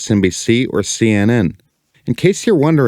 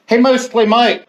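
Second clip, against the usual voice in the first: calling out; 13 semitones higher than usual. Pretend. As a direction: call out high to another room. Calling to someone in bathroom.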